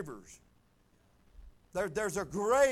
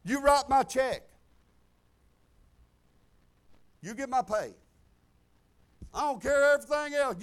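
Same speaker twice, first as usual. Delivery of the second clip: loud, higher, defiant no; firm loud declaration; 3 semitones higher, 10 dB louder than usual. Retold or imitated. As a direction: plant your feet and declare it. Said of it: "And I said".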